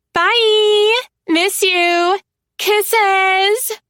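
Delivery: high, loud, sing-song fake goodbye; loud mocking farewell to a departing rival; mock sweet farewell. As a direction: call it after her with fake sweetness. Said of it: "Calliope throws out after her".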